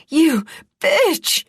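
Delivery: loud high furious howl. A narrator's reading. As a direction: howl the insult at her. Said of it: "Ianthe howled".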